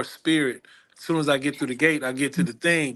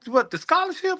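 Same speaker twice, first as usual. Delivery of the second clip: much higher, startled question; asking the crying girl, surprised and much higher. Retold or imitated. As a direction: act caught off guard by her answer.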